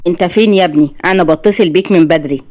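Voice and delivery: usual speaking voice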